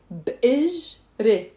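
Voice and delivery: drawn-out syllables for repeating; slow. Shown as a demonstration. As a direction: stretch each syllable as a teacher demo. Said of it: "Let me say it slow".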